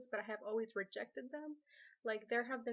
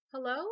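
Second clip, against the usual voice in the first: "Hello" louder, pitch climbing at the end; questioning hello; 3 semitones higher, 7 dB louder than usual. Retold or imitated. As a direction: say "Hello" with an audible question mark. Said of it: "in a question mark".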